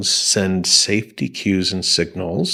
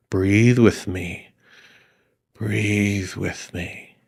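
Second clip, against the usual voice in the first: very slow, soft "breathe with me"; talking a panicking friend into slow breaths, soft and slow; slow soothing; much slower than usual. Shown as a demonstration. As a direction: guide their breathing gently. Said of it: "The soothing, soft, slow voice".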